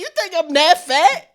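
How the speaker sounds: indignant retort. Retold or imitated.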